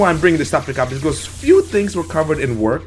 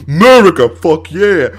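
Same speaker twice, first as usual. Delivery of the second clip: mock patriotic cheer; 3 semitones higher, 9 dB louder than usual. Claiming America as his country, mock patriotic shout. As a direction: belt it out like a rowdy patriot.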